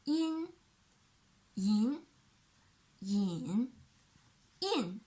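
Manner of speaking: "yin" in each tone; four tones demo. Shown as a demonstration. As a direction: run "yin" through the tones. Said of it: "The first one".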